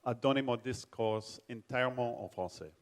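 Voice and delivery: careful French with a heavy American accent; self-conscious, heavily American-accented French. Real, not acted. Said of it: "mon accent, sont absolument terribles".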